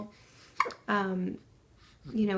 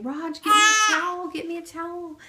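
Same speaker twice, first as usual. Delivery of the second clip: high-pitched imitation of mom calling out; calling out urgently; 8 semitones higher, 5 dB louder than usual. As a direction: shout it high, then ease off but stay high. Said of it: "she was calling Raj".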